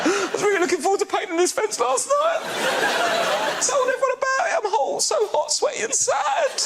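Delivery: very high-pitched comic wail; sad and vulnerable. Retold or imitated. Drugged-up man painting a fence, mock sobbing sad. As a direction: whimper and wail as if falling apart. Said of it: "painting a fence all sad".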